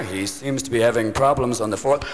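hushed TV golf commentary; hushed golf commentator. Retold or imitated. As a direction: murmur it like TV golf coverage. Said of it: "the commentator".

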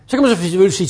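usual speaking voice

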